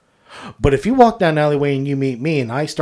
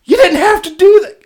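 higher, louder exasperated protest; laughing protest; 14 semitones higher, 5 dB louder than usual. Retold or imitated. Scolding his friend Billy, laughing exasperated protest. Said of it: "And I'm like".

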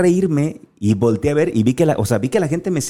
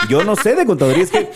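tono muy agudo, confesión divertida; entre risas; 11 semitones higher than usual. Real, not acted. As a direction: admitirlo riendo, con la voz muy arriba. Ríe al confesar su ignorancia contable. (entre risas, voz aguda)

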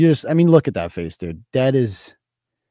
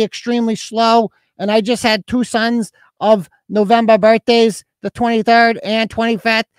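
put-on Newport Lights accent; 8 semitones higher, 4 dB louder than usual. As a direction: read her post in a high, monotone put-on voice. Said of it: "She clearly speaks in, like, the Newport Lights accent".

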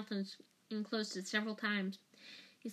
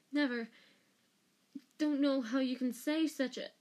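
higher, mildly panicked denial; a touch of breathless indignation; panting protest; 4 semitones higher, 4 dB louder than usual. A narrator's reading. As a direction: deny it in a flustered rush. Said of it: "He was almost panting for breath".

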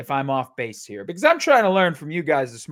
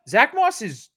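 ordinary delivery

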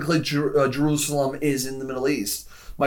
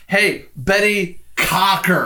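mocking; 5 semitones higher, 4 dB louder, much slower than usual. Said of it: "laughing about, like".

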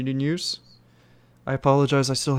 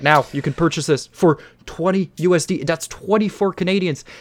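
normal talking voice

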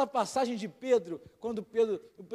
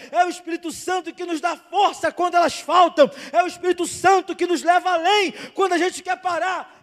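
preaching at full force; 6 semitones higher, 8 dB louder than usual. Acted. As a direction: preach it out to the whole church, building. Pastor preaching to congregation. (pregação inflamada, em voz alta)

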